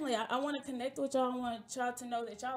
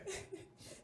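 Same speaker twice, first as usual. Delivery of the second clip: laugh; 8 semitones higher, 10 dB quieter than usual. Real, not acted. Laughing at her own bit.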